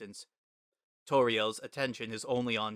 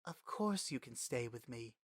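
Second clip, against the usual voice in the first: much softer, emotion held in; trembling voice; 10 dB quieter than usual. A narrator's reading. Toriel promising Frisk a home, soft trembling. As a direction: say it softly, moved. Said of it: "her voice is trembling".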